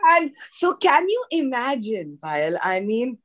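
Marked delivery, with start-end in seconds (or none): 0.0-2.2 much higher and slow, full of wonder; excited. Real, not acted. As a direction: savour the amazement of the punchline. Climax of the Hopkins prank story.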